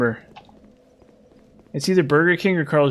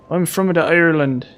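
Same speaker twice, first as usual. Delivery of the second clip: Irish leprechaun. Pretend. Streamer voices a leprechaun version of the character, hammed-up Irish accent. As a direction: play a cheeky Irish leprechaun, bouncy. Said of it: "I'm a leprechaun".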